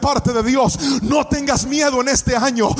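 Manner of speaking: loud, shouted sermon close; fervent preacher telling the church not to fear; full-force preaching. Acted. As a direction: urge them on with fire. Preacher closing exhortation.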